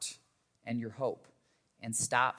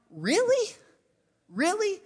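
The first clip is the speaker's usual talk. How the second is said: much higher, louder, exasperated Really? Really?; incredulous road rage; 8 dB louder than usual. Retold or imitated. Pastor retelling his road rage at an angry driver, exasperated and incredulous. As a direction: throw up your hands at the other driver, fed up. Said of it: "And I'm like".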